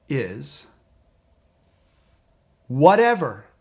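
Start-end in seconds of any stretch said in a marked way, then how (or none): 2.7-3.4 a loud, dismissive whatever; emphatic whatever. Shown as a demonstration. Coach shows the word, loud and dismissive. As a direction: toss it off loudly, like a brush-off. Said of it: "And you say that word".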